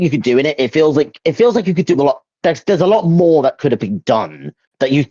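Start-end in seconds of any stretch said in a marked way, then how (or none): none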